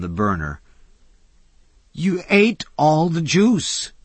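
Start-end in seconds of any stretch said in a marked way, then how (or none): 1.9-3.9 slow, bitter complaint, pitched high; a cook bitterly blaming the guest for the burnt stew. A narrator's reading. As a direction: grumble it with resentment. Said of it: "he said bitterly".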